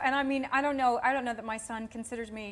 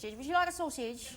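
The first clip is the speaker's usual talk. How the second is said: mock English accent, higher pitch; English sausage hag; 5 semitones higher than usual. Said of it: "will you do your English accent for us".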